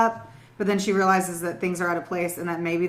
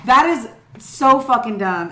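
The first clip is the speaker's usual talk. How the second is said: slow, loud, exasperated verdict; emphatic scorn; 4 semitones higher, 8 dB louder, slower than usual. Real, not acted. Host reacting to a suspect's flimsy lie, exasperated scorn. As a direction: drag it out, loud and higher-pitched.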